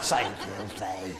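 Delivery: low, slow, soft "filthy"; drawn-out "filthy". Shown as a demonstration. Comedian coaxing audience member to say it, slow hushed demonstration. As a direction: draw out "filthy" quietly, coaxing a repeat. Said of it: "say filthy like that".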